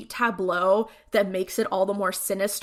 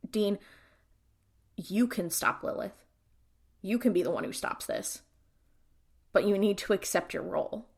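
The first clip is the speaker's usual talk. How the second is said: soft, halting imitation of reluctance; reluctant; 6 dB quieter than usual. Cass telling Dean to accept his role, quietly reluctant. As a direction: say it quietly with long pauses, as if pulled out of you. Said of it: "saying these words as if they're being, like, pulled out of him reluctantly".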